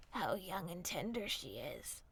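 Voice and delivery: low cunning wolf; sly wolf. A narrator's reading.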